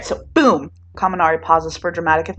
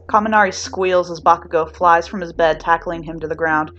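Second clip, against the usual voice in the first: ordinary delivery, nothing marked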